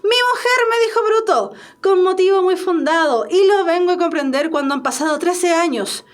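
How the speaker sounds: high, ringing declaration of triumph; triumphant. Acted. Cleto straightening up after his blunder, triumphant tone. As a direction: proclaim it like a sudden victory. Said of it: "dice con tono de triunfo".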